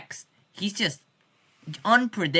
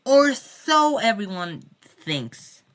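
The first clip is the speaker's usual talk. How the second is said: slow, higher and louder, like a movie trailer reveal; dramatic twist; 7 semitones higher, 6 dB louder, slower than usual. Pretend. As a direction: drag it out as an ominous plot twist. Ominous twist after praising track.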